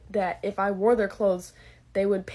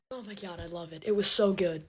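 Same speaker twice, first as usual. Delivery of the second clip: ordinary talk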